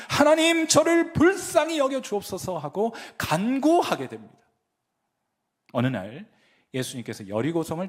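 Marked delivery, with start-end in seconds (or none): 0.1-2.6 high, loud pleading prayer; pleading cry. Retold or imitated. David pleading loudly with God for mercy. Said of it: "간구했는가 하면".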